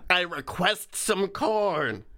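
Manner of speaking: slow, put-on goblin demand; creature voice. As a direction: play a hobgoblin asking for corn. Voicing the Puka's demands.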